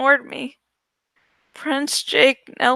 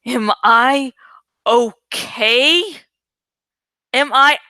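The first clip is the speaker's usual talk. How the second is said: louder, rattled angry question; 5 dB louder, slower than usual. A narrator's reading. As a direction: snap it out angrily. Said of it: "in a loud, angry voice".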